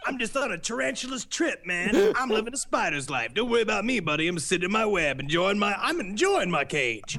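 hammed-up squawky madman; Charles Manson. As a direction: chatter like a little caged parakeet. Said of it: "he's like a little parakeet".

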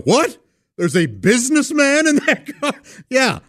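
high-pitched sarcastic astonishment; mock amazement. Imagined doorman hearing of VPs in the car, mock amazed. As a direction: pretend to be hugely impressed, hammed up. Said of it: "the door guy was gonna go".

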